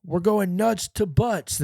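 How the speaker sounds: imitated officer laying down the rules; corrections officer. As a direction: put on a strained, high-pitched officer voice. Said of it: "the corrections officer going".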